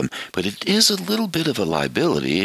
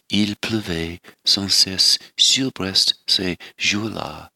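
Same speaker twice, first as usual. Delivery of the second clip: poem recitation in French. Shown as a demonstration. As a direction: savour each French line, gently. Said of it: "I'll give you a little sample of it now".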